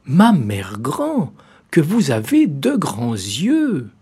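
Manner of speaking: put-on the child growing uneasy; little girl. A narrator's reading. Girl questions the grandmother.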